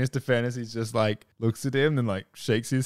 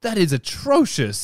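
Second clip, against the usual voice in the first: slow, drawn-out snooty disdain; 6 semitones higher, slower than usual. Retold or imitated. Rich man judging her job, slow and snooty. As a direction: pronounce it like a snob. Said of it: "And he's like".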